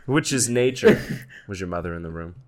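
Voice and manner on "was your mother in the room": soft, probing therapist question. Acted. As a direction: ask it gently like a counselor. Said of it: "That was my impression of a therapist".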